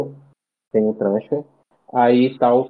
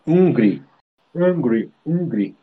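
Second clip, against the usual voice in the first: hungry said with the Irish regional vowel, uh-gree; regional Irish accent; 2 semitones higher, slower than usual. Shown as a demonstration. Mimicking how a local Irishman says it, Irish accent. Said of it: "Ele fala hungry".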